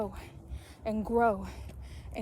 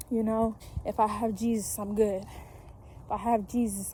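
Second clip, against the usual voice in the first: ordinary talk, nothing marked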